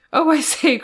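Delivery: delighted burst of surprise; surprised cry. A narrator's reading. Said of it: "cried Ethel in surprise".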